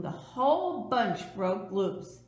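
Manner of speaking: ordinary delivery, nothing marked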